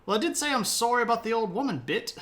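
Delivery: high, loud and fast apology; flustered king. Retold or imitated. King Arthur excusing himself, high and hurried. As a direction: rush out a huffy excuse. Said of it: "king apologising".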